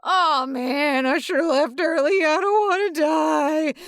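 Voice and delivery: high, hammed-up exhausted whine; mock panting complaint, drawn out at the end; acted out of breath. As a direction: wail it as if winded from running. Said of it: "He's out of breath".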